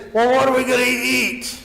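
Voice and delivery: whiny complaint. Retold or imitated. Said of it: "they start grumbling".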